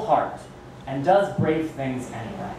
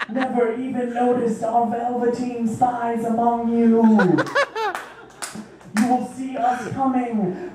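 Bunnicula; 8 semitones higher, slower than usual. Acted. Persona poem climax.

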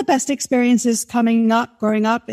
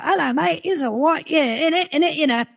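higher-pitched Cockney mimicry; Cockney dad; 5 semitones higher than usual. Guest imitating her Cockney dad to the host, Cockney accent. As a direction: do Dad's matey Cockney patter. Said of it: "my dad comes from a Cockney".

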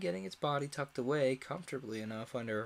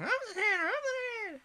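very high, loud raging cry; queen raging over the croquet; furious shouting Queen; 4 dB louder than usual. A narrator's reading.

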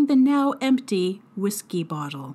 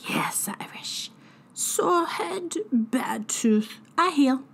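put-on whispery, cracked old fairy voice; a wheedling drunken hag; half-whispered, crying old sprite; croaky fairy healer; 5 semitones higher, slower than usual.

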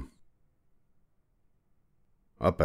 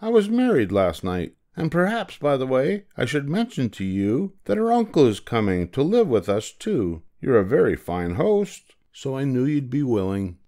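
high, perky bird voice; chatty bird; 6 semitones higher than usual. A narrator's reading. Bird telling his host of more guests, high and breezy. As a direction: breezily announce it, pitched up.

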